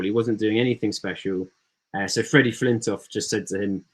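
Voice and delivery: ordinary talk, nothing marked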